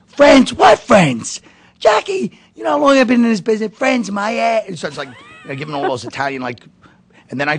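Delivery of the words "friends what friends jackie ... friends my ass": shouted very high and loud, outraged; old comedian bellowing at Jackie, loud and high; furious screaming. Retold or imitated. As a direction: scream it at your old friend. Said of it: "he starts screaming at me".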